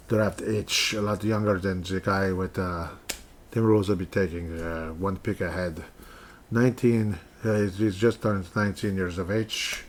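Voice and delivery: put-on Russian accent. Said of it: "my Russian accent".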